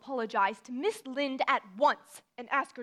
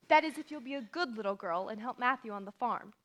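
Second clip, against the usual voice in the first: ordinary talk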